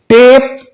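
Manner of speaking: टेप held on a high "ay"; long A. Shown as a demonstration. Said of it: "long sound हो गया". (टेप में लंबा ए)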